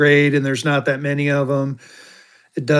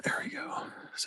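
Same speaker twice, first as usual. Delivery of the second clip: whisper; 21 dB quieter than usual. Real, not acted. Adjusting light under his breath.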